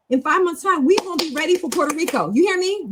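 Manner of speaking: high-pitched, insistent hype; rally the class, pitch well up; rallying pep talk. Real, not acted. Teacher rallying learners to be ready for Puerto Rico, insistent hype.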